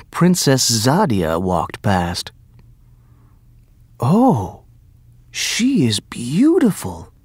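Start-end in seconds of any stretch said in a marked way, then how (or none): 4.0-7.1 higher-pitched, awestruck exclamation; admiring cry. A narrator's reading. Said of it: "cried Aladdin".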